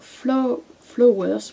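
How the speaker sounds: English words in a strong French accent, slowly; bad English accent. Real, not acted. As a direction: stumble through the English title with a French accent. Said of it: "mon accent anglais est pourri". (très mauvais accent anglais, à la française)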